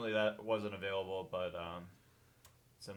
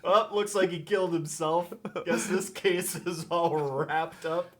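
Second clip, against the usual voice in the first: slow, laughing mock detective; deadpan Batman quip; 7 semitones higher, 4 dB louder than usual. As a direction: wrap up the case with a laugh.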